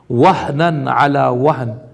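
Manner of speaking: fast, chanted Arabic recitation; تلاوة القرآن; Quran recitation. Acted. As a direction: recite the verse in tajweed. Recites verse.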